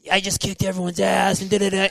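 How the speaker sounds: gloating to himself after winning; a lightly played cocky cheer; hyped boast. Hyped recalling podium win.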